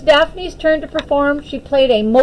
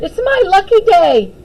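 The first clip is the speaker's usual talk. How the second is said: gleeful; 9 semitones higher, 5 dB louder than usual. A narrator's reading. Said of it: "she cheered".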